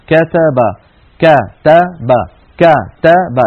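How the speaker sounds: كتب in separated syllables, each a wide-open "a"; كتب punched out syllable by syllable, ka-ta-ba; open-mouthed fatha. Shown as a demonstration. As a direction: drop the jaw on every vowel of كتب. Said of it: "لا بد من فتح الفم".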